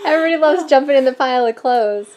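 loud and laughing. Real, not acted. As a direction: laugh through it loudly. Laughing while jumping.

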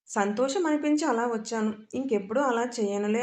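ordinary delivery

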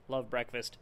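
normal talking voice

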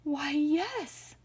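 high, gasping bear realization; high-pitched alarmed agreement; gasped alarm. A narrator's reading. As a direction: gasp it out, startled. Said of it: "gasped the bear".

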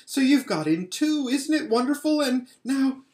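high, loud, delighted; excited girl. A narrator's reading. Lucy delighted Edmund came to Narnia, excited. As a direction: bubble over with joy.

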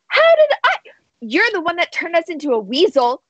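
high-pitched, quickening indignant protest; indignant retort. Acted. Ollie arguing through closed doors, indignant. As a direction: throw the blame back, voice shooting up.

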